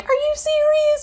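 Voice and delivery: high mocking "are you serious"; mocking Megan. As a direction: parody her disbelief in a squeak. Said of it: "Megan's, you know, like".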